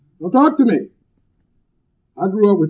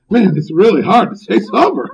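mock out of breath; 3 semitones higher, 3 dB louder than usual. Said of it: "breathing hard".

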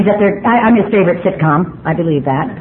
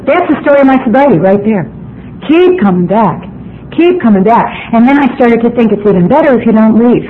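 fired-up preaching. Acted. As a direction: preach it to the whole meeting. Preaching at full force to the room.

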